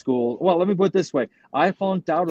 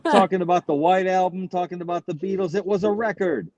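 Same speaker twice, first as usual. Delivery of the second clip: high-pitched comedy routine, climbing higher as it goes; Sam Kinison; 4 semitones higher than usual. Retold or imitated. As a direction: imitate a stand-up's rant, voice rising steadily. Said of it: "Sam Kinnison's routine about the Manson killers".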